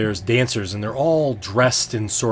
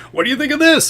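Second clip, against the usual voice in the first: high, loud booming jester laugh and boast; much higher and louder, hamming it up; jolly Fabler; 6 dB louder, faster than usual. Acted. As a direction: play the bold, showy fool at the feast. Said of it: "he's like".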